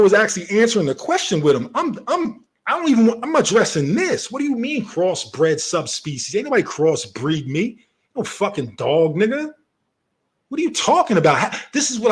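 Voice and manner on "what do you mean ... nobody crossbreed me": usual speaking voice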